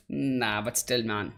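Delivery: ordinary talk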